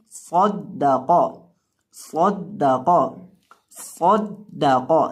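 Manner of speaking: सौद दा कौ, heavy first and last, thin दा; thick and thin letters. Shown as a demonstration. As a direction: pronounce सौद दा कौ deliberately, holding the d. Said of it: "सौद को हमने मोटा करके पढ़ना है". (صَدَّقَ موٹا صاد، باریک دال، موٹا قاف)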